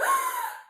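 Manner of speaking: sharp high gasp; shocked gasp. A narrator's reading. Bear cub seeing his hat unravel, high gasp. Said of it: "Douglas gasped".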